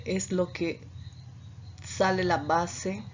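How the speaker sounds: ordinary talk, nothing marked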